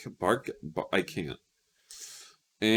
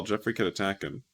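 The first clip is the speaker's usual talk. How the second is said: ordinary talk, nothing marked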